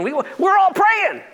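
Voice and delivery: high, slow desperate shout; captain hollering we're all praying. Acted. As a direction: yell at the sleeper to wake up. Captain shouts at sleeping Jonah.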